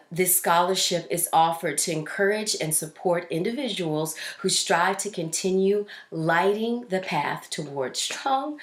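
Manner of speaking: ordinary delivery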